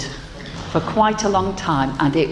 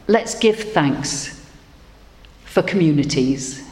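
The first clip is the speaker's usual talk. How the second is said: leading prayer. Acted. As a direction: lead the congregation gently into thanks. Leads congregational prayer.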